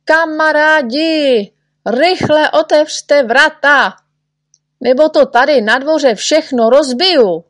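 high, loud, urgent warning shout; shouting at the top of voice. A narrator's reading. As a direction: yell to friends to clear the way. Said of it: "neználek křičel na celé kolo".

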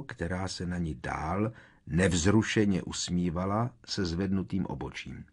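ordinary delivery

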